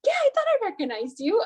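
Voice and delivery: very high imitation of her answer; lightly voiced lady; imitated lady. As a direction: mimic her casual reply. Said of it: "She's like".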